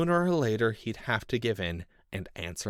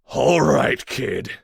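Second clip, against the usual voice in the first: loud threatening snarl; 5 semitones higher, 12 dB louder, much slower than usual. A narrator's reading. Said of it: "Whitman snarled".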